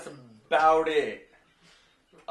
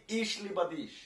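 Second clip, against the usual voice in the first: harsh German; 4 semitones higher, 4 dB quieter, slower than usual. Retold or imitated. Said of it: "But it all sounds angry".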